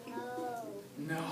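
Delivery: ordinary talk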